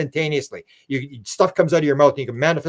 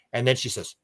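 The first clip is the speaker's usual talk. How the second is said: ordinary talk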